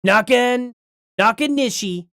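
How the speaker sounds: lightly put-on presidential accent on a name; a hint of political impression; Donald Trump. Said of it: "I wanna mispronounce that for Trump".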